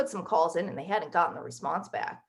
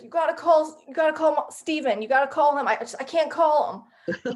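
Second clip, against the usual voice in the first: higher, louder urgent pleading; retold frantic plea; 6 semitones higher, 4 dB louder than usual. Woman recalling calling her aunt after diagnosis, frantic plea. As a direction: plead urgently, pitched up and stumbling over it.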